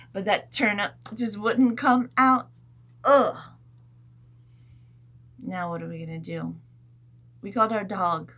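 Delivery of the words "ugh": loud frustrated grunt; effort grunt. Pretend. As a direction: give up the pull with a groan. Failed pull.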